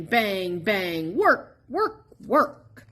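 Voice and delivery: usual speaking voice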